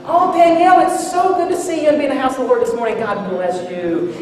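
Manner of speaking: sugary mock Sabbath welcome; fake cheery churchgoer. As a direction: gush it like a showy hypocrite. Hypocritical dad greeting at church.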